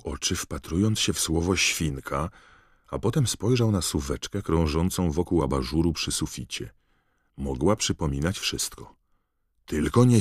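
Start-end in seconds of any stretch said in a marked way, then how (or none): none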